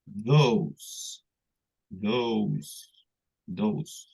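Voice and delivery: Those with a long hissed s; teacher demo of Those. Shown as a demonstration. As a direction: model Those slowly. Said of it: "Y este de acá".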